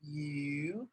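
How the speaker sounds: "yu" spoken slowly and clearly as the Mandarin word for fish; slow, careful "yu". Shown as a demonstration. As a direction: draw out "yu" carefully for learners. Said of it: "it's pronounced".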